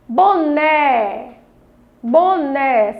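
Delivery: BONÉ drawn out, NÉ held; syllable stress demo. Shown as a demonstration. Teacher asks children for the tonic syllable, Boné stressed on NÉ. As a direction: lean long on the last syllable of Boné. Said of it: "ler com maior intensidade a palavra".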